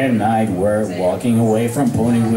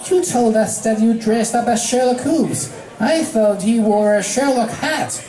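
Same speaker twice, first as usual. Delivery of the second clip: filly voice; 9 semitones higher than usual. Acted. Dinky voiced character.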